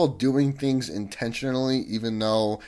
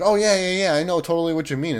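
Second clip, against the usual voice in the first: much higher, louder, fast gushing; mock eager interrupter; 7 semitones higher, 6 dB louder, faster than usual. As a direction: rush in over someone's story. Mocking someone who cuts in.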